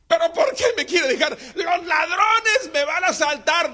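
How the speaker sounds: loud, high, slow mock panic; mock fear. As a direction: shout about the robbers in hammed-up fear. Chanito fears robbers.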